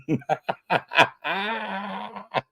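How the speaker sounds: a long, high burst of laughter. Real, not acted. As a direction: crack up high at the viewer's take. Laughing at a viewer's comment.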